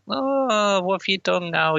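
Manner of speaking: high whining mock sissy; whiny high voice. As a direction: whine it up high. Danny's all.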